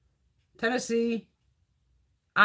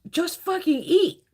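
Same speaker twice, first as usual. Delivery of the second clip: exasperated plea; 9 semitones higher, 3 dB louder, slower than usual. Retold or imitated. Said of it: "And we're like".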